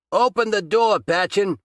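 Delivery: loud, high angry shout at the door. A narrator's reading. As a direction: bang on the door and yell. Detective beating on locked door.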